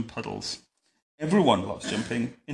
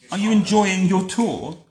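loud, higher, cheery royal enquiry; storybook Queen calling; 6 semitones higher, 5 dB louder than usual. A narrator's reading. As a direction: call back grandly to your passengers. Queen calls to cowering children.

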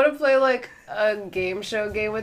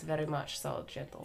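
Russian villain accent; 5 semitones lower, 16 dB quieter than usual. Shown as a demonstration. Showing friends his villain accent, low gentle Russian. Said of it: "do your, uh, villain accent".

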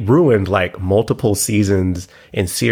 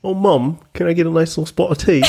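much higher, fast mock posh British accent; 7 semitones higher, faster than usual. Shown as a demonstration. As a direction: ham up a prim English kid asking for tea. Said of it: "Let me hear yours".

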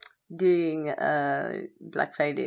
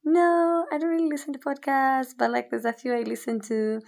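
friend's voice; 9 semitones higher than usual. Retold or imitated. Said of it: "And she was like".

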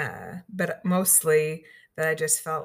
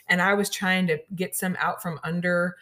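ordinary delivery

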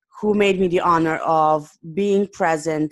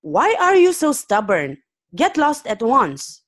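higher, exasperated demand to leave; upset and angry; 6 semitones higher, 3 dB louder than usual. Acted. Angry man ordering stubborn Arian away, exasperated. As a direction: read it as a man losing patience. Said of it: "that made the man even more upset".

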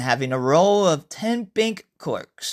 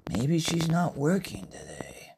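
hushed; 7 dB quieter than usual. Acted. Usuke wondering whether she's there.

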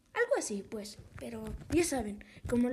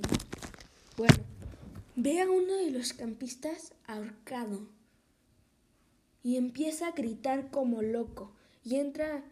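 normal talking voice

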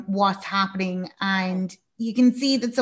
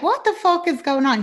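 raised, high-pitched mock freak-out; louder, higher quoted exclamation; retold alarmed outburst; 8 semitones higher, 5 dB louder than usual. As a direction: imitate someone demanding answers in alarm. Acted alarm at the blood.

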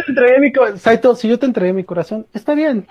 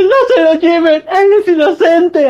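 mock crying plea; 8 semitones higher, 3 dB louder, slower than usual. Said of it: "y llorando".

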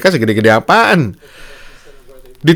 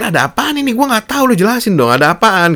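high, bewildered questioning voice, much higher than usual; confused man; 10 semitones higher than usual. Retold or imitated. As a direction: imitate a confused man asking what's going on. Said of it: "Dia pikir".

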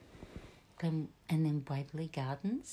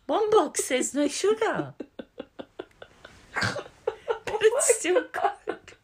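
high and loud, slow, dissolving into laughter; laughing; 13 semitones higher, 10 dB louder, much slower than usual. Real, not acted. Mum retelling the sugar-free Coke argument, laughing hard. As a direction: squeeze the words out between laughs.

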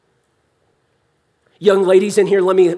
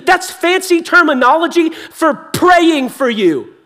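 raised, driving pulpit delivery; impassioned preaching; 8 semitones higher, 7 dB louder than usual. Real, not acted. Preacher assures congregation of God's love, impassioned preaching. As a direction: preach it with mounting fervour.

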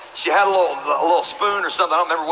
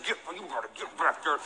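much softer, hissed through clenched teeth; 12 dB quieter than usual. Retold or imitated. Pastor imitating his mother threatening through clenched teeth. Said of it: "could say things through clenched teeth".

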